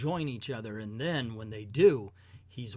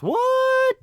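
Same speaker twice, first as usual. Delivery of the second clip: shocked outburst; 12 dB louder than usual. Acted. Superman reacts in disbelief.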